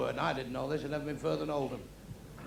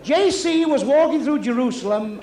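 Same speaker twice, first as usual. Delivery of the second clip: solemn storytelling; 6 semitones higher, 8 dB louder, slower than usual. Acted. Comic sets up Bible gag.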